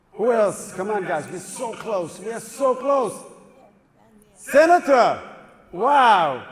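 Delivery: ordinary talk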